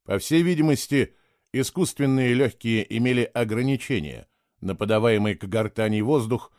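usual speaking voice

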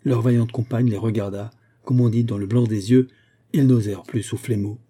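ordinary delivery, nothing marked

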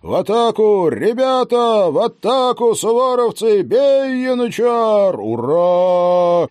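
high, loud charging yell; battle cry. Acted. Hussar commander leading the attack, a roaring battle cry. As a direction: lead the charge at full voice.

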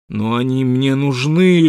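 slow, loud whine of need; plaintive. A narrator's reading. Wild Water pleads he needs the eggs, plaintive. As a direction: whine like a thwarted suitor. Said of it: "жалобно сказал Уайлд Уотер".

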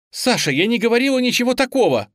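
high, loud, rapid protest; exclaiming. A narrator's reading. Girl denying gossip to Sasha, urgent exclaiming. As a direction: blurt out the denial urgently. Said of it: "воскликнула девушка".

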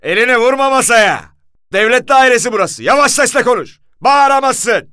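furious high, very loud bellow; thunderous shout. A narrator's reading. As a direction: roar orders like thunder. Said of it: "Komiserin gök gürültüsü gibi sesiyle".

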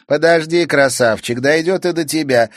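high, loud mocking girl; taunting Sayana. A narrator's reading. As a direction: taunt the boy in the cage. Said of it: "ответила ему Саяна".

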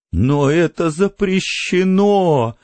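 high, drawn-out whine; plaintive wail. A narrator's reading. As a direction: wail the protest, stretching the last word. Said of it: "жалобно возопила миссис Бауэллс".

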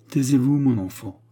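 usual speaking voice

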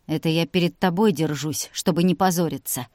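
ordinary delivery

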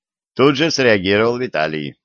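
usual speaking voice

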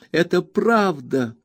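high, slow tearful insistence; Emily crying out to Ham. A narrator's reading. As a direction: insist through tears. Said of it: "воскликнула малютка Эмли".

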